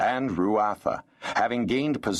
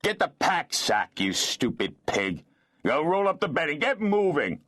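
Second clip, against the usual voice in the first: higher, snarling rage at his wife; bitter snarl; 10 semitones higher than usual. Acted. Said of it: "snarling in bitter rage".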